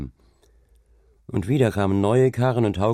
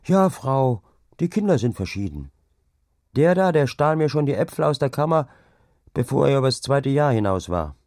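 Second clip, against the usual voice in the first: higher-pitched, lively calling voice; called out; 6 semitones higher than usual. A narrator's reading. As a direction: call it out heartily to another woman. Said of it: "rief dann wohl". (lebhaft gerufen)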